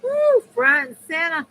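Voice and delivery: high, loud woo and quick call; excited whoop. Real, not acted. Streamer cheering to viewers, excited whoop.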